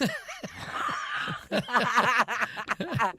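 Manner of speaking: bright burst of laughter; laughing. Real, not acted. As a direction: laugh at her own accent. Laughs after her Geordie line.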